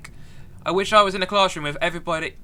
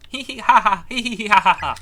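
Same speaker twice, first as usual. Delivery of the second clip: hammed-up louder hee hee ha ha; mock giggle; 3 semitones higher, 6 dB louder than usual. Reading a ghost's giggle to chat, mock louder laugh. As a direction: chant a fake sing-song giggle.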